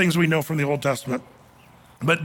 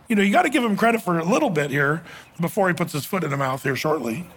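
ordinary talk

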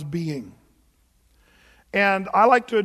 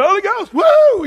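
a much higher, louder whoop of holy joy; hammed-up charismatic outburst; ecstatic shout; 13 semitones higher, 12 dB louder than usual. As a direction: cut loose like a revival worshipper swinging from the chandelier. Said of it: "swinging from the chandelier".

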